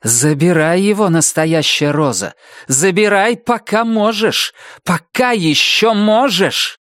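high, rising urgency; alarmed. A narrator's reading. Роза Марена urging Rosie to take him, alarmed. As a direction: plead anxiously, stretching the words as panic mounts. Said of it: "зазвучало смятение и даже тревога".

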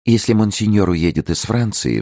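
a touch of wistful worry; sighing. A narrator's reading. Lady worrying about monseigneur leaving France, gentle sigh. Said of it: "вздохнула госпожа де Бельяр".

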